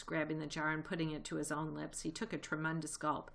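plain reading voice

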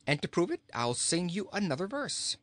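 high, cheery reply; jolly king, lilting upward. A narrator's reading. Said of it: "was the king's cheery reply".

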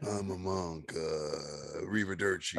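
low, lazy fake Italian accent; a bad Italian accent for a monk; mock Italian accent. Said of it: "Tobin Bell doing a really shitty, like, Italian accent".

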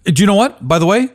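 usual speaking voice

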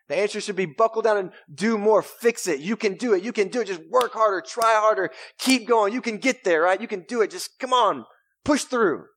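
a hammed-up motivational cheer; mock pep talk. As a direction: pump them up like a gym coach. Mimics the world's pep-talk answer.

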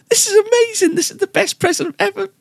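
very high, squeaky imitation of overjoyed wife; tearful delight. Wife thanking husband for the present, tearfully overjoyed. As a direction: mimic her squealing, overwhelmed with happy tears. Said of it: "tears started, um, welling up in her eyes".

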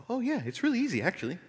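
high, breezy mocking tone; mock breezy reply. Pastor mocking the easy answer, breezy and high. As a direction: toss it off like it's nothing.

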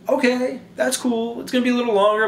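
high-pitched, half-hearted okay; reluctant acceptance. Acted. Friend told the trip is to Manhattan, reluctantly agreeing.

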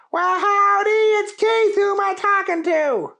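a folksy cowboy answering the phone, big and high; Keith voice. Acted. Keith's voice in story.

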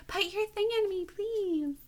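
hammed-up airhead begging; dumb-girl voice. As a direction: play her dim and needy, way up in pitch. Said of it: "dumb, so I said it like that".

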